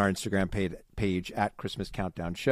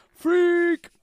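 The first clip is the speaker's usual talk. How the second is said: "freak" drawn out long and high; all-caps FREAK; 7 dB louder than usual. Acted. Podcaster honoring a listener's all-caps message, "freak" long and emphatic. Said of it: "she did all caps".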